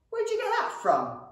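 demanding. A narrator's reading. Goblin demands of his brother, insistent. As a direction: demand an answer. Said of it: "he demanded".

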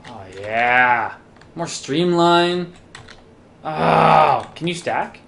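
usual speaking voice

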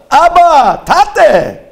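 loud high call to his father. Retold or imitated. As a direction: call out Abba, Tate across the ocean. Calling out to father.

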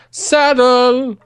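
high, loud, long-held cry; excited find. Real, not acted. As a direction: sing out the discovery.

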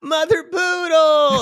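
high, loud, slow hammed-up yell; mock angry shout. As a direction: bellow the name like an angry bartender. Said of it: "Like when Carla walked in, angry".

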